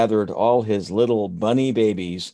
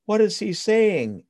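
1 little bunny voice; 12 semitones higher than usual. Acted. Ruthie asks her father.

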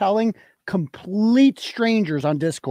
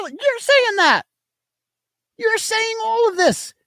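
very high, straining with indignation; heated accusation; 14 semitones higher than usual. Real, not acted. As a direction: accuse her in an exasperated high pitch. Accusing the absent mother.

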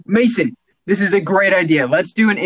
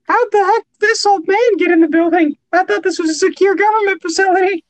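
much higher, suspicious clerk; nervous employee voice; 11 semitones higher than usual. Acted. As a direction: squeak out the suspicion. New improv character, suspicious.

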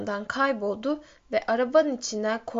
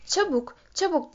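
restrained narrated cry, pitched up; mildly raised, hurrying call; urgent shout; 6 semitones higher than usual. A narrator's reading. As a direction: call out urgently without much volume. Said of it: "diye bağırdı Benjamin".

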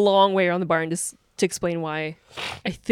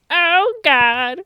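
put-on old person groan; 10 semitones higher, 5 dB louder than usual. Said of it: "look back on this and be like".

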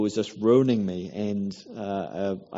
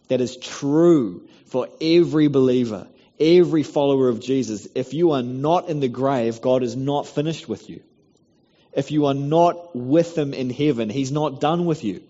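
full-force preaching; 4 semitones higher, 6 dB louder than usual. Acted.

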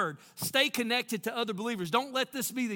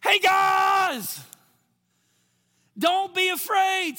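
loud, high, drawn-out holler; shouting across the storm; 11 semitones higher, 10 dB louder, much slower than usual. Acted. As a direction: call out to friends far off over the waves. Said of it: "Can I tell you how this went down?".

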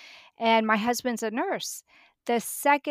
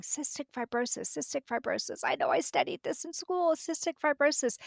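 retold frantic thinking, soft and rising higher; racking the brain; 5 semitones higher, 3 dB quieter than usual. Mother retelling her search for the diagnosis in memory, racking her brain. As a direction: think aloud softly, pitch climbing as you search. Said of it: "I'm racking my brain thinking".

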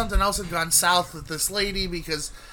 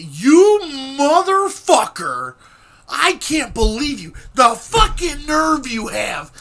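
high, loud outraged phone tirade; furious yelling; 8 semitones higher, 7 dB louder, slower than usual. Retold or imitated. Imitating his friend cursing him on the phone, yelling. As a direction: yell it drawn out, imitating an incensed friend.

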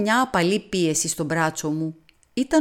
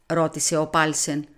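ordinary delivery, nothing marked

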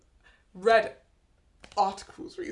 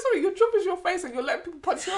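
incredulous; 8 semitones higher, faster than usual. Retold or imitated. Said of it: "I was like".

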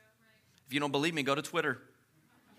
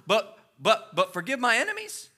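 ordinary delivery

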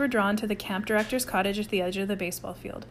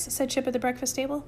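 ordinary delivery

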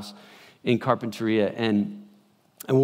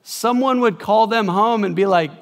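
ordinary talk, nothing marked

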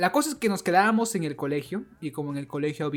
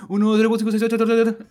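counting fast; 6 semitones higher, 8 dB louder, much faster than usual. Shown as a demonstration. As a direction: rattle off the numbers loud and quick. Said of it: "contabas rapidito así".

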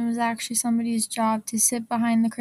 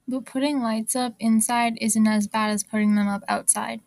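ordinary talk

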